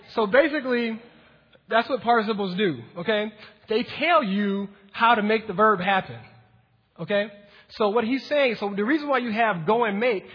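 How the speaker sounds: building preaching. Acted. Pastor fires up the congregation, loud preaching.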